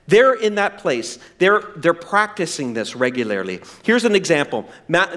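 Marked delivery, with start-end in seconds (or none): none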